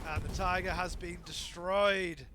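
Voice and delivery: loud slow play-by-play call; big call. Real, not acted. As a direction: land the kill call with weight. Caster calls tank kill.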